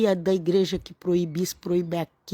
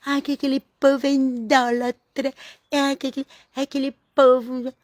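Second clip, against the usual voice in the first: higher-pitched mimicry of a judgmental believer; mock name-calling; 5 semitones higher than usual. Convert badmouthing other religions, mock name-calling. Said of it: "começa a chamar, dizer que".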